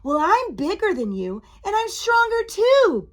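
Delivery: puffed-up storybook boast; boastful. A narrator's reading. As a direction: brag with rising pitch and force. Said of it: "boasted the ghost".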